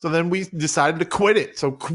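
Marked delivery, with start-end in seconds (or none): none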